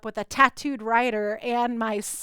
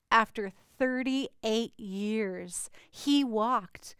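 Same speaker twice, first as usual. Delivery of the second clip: slow emphasis; much slower than usual. Acted. Echoes earlier dramatic phrase.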